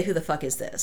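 low, blunt, falling delivery; rude. Retold or imitated. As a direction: brush someone off rudely. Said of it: "I didn't say".

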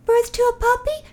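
put-on high, innocent kid question; little kid voice. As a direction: ask it like a clueless child.

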